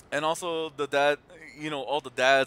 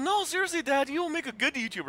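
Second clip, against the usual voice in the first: high insistent daughter; 9 semitones higher than usual. Retold or imitated.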